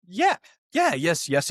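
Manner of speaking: high-pitched, overeager yes; retold excited acceptance; eager agreement. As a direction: jump at the offer, voice shooting up then settling. Acting out eager yes to zoo.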